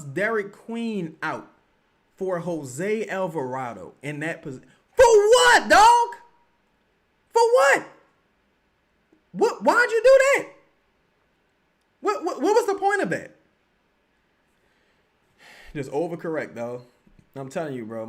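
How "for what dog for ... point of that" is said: high, loud demand for a reason; outraged shout. Real, not acted. Streamer yelling at the coach about the sub, high loud outrage. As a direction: yell at the coach in disbelief.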